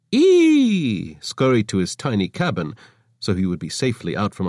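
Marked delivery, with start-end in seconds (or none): 0.1-1.2 high, sudden squeak of alarm; startled squeak. Acted. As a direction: yelp in a high little voice. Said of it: "Avra Helvachi said".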